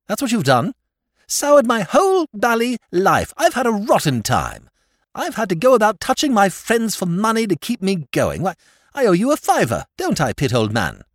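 high, loud complaining rant; aggrieved outburst. Acted. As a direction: pour out years of grievance. Nephew ranting about soured life.

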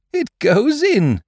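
high, loud, drawn-out wonder; amazed. A narrator's reading. Pooh watching the balloon go in, amazed. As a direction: marvel slowly and loudly.

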